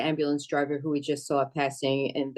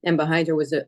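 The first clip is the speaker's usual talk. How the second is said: usual speaking voice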